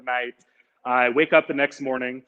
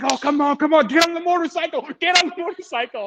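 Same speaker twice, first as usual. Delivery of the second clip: urging stranger; 12 semitones higher than usual. Retold or imitated. Stranger urging traveler onto motorcycle, high and insistent. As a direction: imitate a man hurrying you onto a bike.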